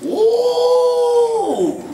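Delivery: high, long wondering oh; a drawn-out high oh of the elders; high exclaiming Oh. Acted. Village elders exclaiming over the pregnant man. Old ones' wordless language.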